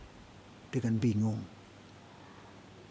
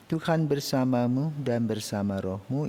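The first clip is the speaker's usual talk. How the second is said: usual speaking voice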